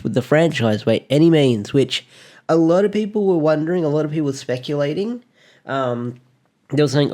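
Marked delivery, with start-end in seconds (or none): none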